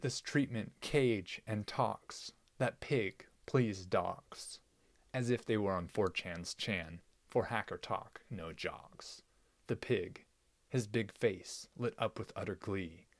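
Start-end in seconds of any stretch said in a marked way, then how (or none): none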